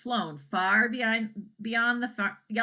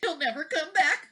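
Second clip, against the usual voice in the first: higher worried thought; 10 semitones higher, 3 dB louder than usual. A narrator's reading. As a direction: say it to herself, worried. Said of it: "thought Missus Wagon Wheel".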